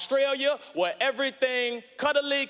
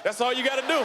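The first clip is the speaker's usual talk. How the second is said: ordinary talk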